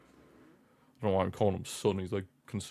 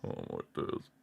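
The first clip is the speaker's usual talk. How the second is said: breathless whispered dread; 10 dB quieter than usual. Real, not acted. Fan whispering in dread before the corner. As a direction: barely breathe the words out. Tense moment, Mexico corner.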